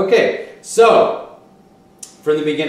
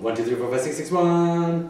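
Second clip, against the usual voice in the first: slow count; 3 semitones higher than usual. Shown as a demonstration. Teacher counting the rhythm slowly for the student. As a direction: count slowing at the end, six, six, one. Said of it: "That's the rhythm there".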